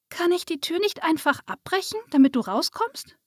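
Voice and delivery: lightly voiced high child; little girl. A narrator's reading. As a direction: ask as an eager young girl. Narrator voices little girl Lisa. (leicht angedeutete hohe Kinderstimme)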